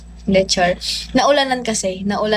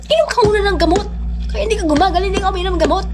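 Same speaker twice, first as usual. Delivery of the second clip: higher, fast scolding mom voice; 9 semitones higher, much faster than usual. Retold or imitated. Mother scolding sick daughter to take medicine, high fast scold. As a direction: nag fast like a worried mother. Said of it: "natatakot ako eh".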